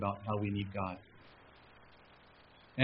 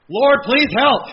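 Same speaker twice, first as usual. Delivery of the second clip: loud, high desperate plea; crying out to God; 14 semitones higher, 16 dB louder, slower than usual. Retold or imitated. Pastor on a busy week, desperate loud plea. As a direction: cry out to the Lord for help. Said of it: "I'm crying out to the Lord more".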